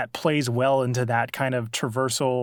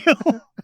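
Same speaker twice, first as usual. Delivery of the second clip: laughing; 11 semitones higher, 5 dB louder than usual. Real, not acted. Laughing at phone drop.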